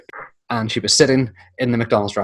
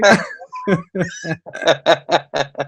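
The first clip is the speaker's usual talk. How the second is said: high breathy laughter; long laugh; 7 semitones higher than usual. Real, not acted. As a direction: keep laughing helplessly. Still laughing.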